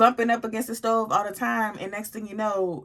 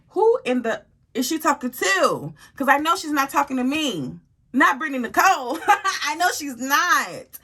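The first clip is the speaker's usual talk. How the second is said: indignant; 5 semitones higher, 3 dB louder than usual. Real, not acted. Indignant retelling to viewers.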